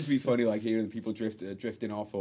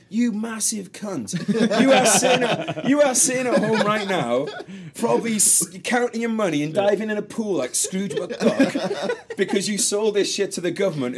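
high, loud, laughing rant; furious rant; 9 semitones higher, 6 dB louder, slower than usual. Retold or imitated. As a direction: rant at the designer. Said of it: "I'm just thinking".

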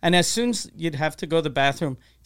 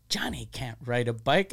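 low, near-whispered reply; soft, whispery dismissal; quoted dad; 4 semitones lower, 6 dB quieter than usual. Retold or imitated. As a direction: drop to a hushed, low voice for dad. Dad's answer, retold.

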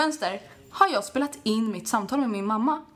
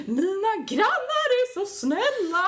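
put-on much higher, slow, laughing drunk gush; 11 semitones higher, slower than usual. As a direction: croon it out the window like a happy drunk. Yelling drunk out window. (ljus, långsam, översvallande fyllröst)

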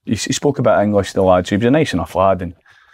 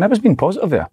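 hopeful inner thought; 10 semitones higher than usual. Retold or imitated. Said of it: "I was going".

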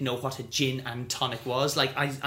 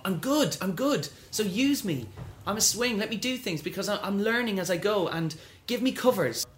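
cocky, higher self-promotion; big-headed; 7 semitones higher than usual. Retold or imitated. Young swing boasting to producers, cocky and higher. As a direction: sell yourself with swagger. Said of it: "sound so big-headed".

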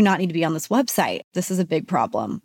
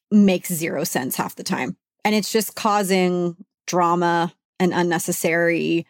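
ordinary talk

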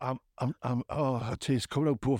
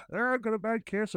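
high silly Muppet-style voice; comedy Muppet voice; 8 semitones higher than usual. Pretend. Host mocking a sick caller in a Muppet voice. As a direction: squeak it out like a Muppet with a cold. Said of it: "a proper comedy voice".

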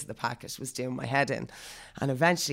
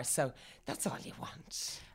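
soft, slow whisper; whispering the conclusion to her friend; whispered aside; 10 dB quieter, slower than usual. Real, not acted. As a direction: breathe the conclusion quietly. Quiet aside to end the point.

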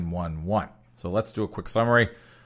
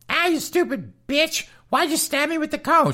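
mock victim voice; 3 dB louder than usual. Host imagining the stabbed sister's high complaint. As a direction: whine it in a squeaky high voice. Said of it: "So instead of saying".

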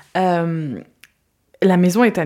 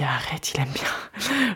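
slow, hushed amazed whisper; whispered disbelief; 5 dB quieter, much slower than usual. Real, not acted. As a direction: breathe it in thrilled disbelief. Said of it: "Je me dis".

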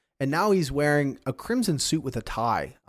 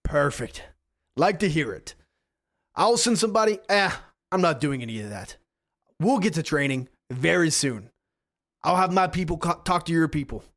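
stern hero boss; 4 semitones higher than usual. Acted. Guardian warning trainees about his non-compete, booming and higher. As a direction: lay down the rules like a smug mentor.

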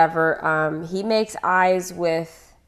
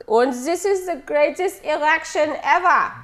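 high, slow, hammed-up German accent; terrible German accent; 8 semitones higher than usual. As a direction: orate in a bad German accent. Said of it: "terrible German accent".

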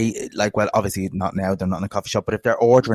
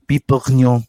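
mock French flourish on bourguignon; affected French accent; 3 dB louder than usual. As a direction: put on French airs for the dish. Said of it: "the accent comes out".

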